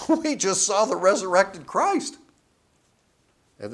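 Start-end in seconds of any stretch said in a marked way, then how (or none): 0.0-2.2 higher, louder eager burst; excited retort. Retold or imitated. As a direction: brush off the long walk, thrilled. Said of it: "In their minds".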